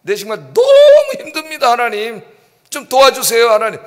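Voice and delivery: demonstrated loud, high cry to God; acted desperate plea for help; pleading prayer. Shown as a demonstration. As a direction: cry out the hardship with long, stretched emphasis. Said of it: "힘들면 힘들다고 얘기하세요".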